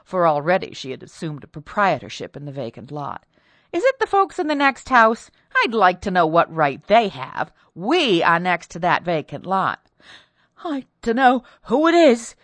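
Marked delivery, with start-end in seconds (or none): 3.7-9.8 indignant. A narrator's reading. Mistress claiming the vacant lot, loudly indignant. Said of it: "rousing to indignation". 10.6-12.4 high, loud, distressed maid's voice; frightened maid. A narrator's reading. Frightened maid answers her mistress, high and distressed.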